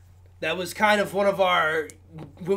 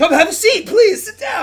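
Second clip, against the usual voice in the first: excited; 13 semitones higher, 9 dB louder than usual. Retold or imitated. Retold invite, raised voice.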